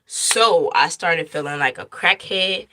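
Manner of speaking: ordinary talk, nothing marked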